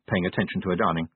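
ordinary delivery

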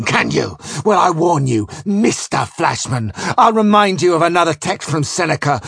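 loud, high, emphatic rage; furious tirade. A narrator's reading. Captain warning Flashman with Seneca on the anger of kings, furious. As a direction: hammer out each threat like an enraged tyrant.

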